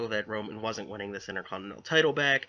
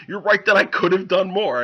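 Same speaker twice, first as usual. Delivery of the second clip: loud, higher sarcastic burst; sudden mock outburst; 8 semitones higher, 9 dB louder than usual. Braun snapping back at Kurt Angle, loud and mocking. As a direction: erupt with it.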